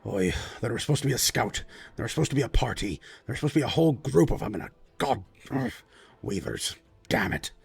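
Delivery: lightly voiced grumbling lord, muttering to himself. Lord grumbling about the missing scouts, lightly voiced annoyed mutter. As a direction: grumble it under your breath, annoyed. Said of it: "he grumbles and he goes".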